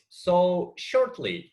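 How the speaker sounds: shortly drawn out slowly and leaned on; stress on shortly. Shown as a demonstration. Teacher demonstrating to a talkative student, stress on shortly. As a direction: stretch the word shortly to make the point. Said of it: "I emphasize that".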